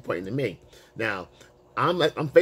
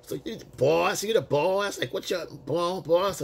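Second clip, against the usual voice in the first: lightly mimicked woman, higher pitch; woman at the bar; 6 semitones higher than usual. Woman challenging him face to face, mock taunting. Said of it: "She goes".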